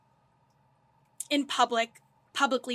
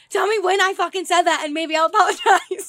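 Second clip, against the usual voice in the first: loud, higher argumentative outburst; retold heated demand; 6 semitones higher, 9 dB louder than usual. Retelling argument with friend, heated demand. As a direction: replay a fight at full volume.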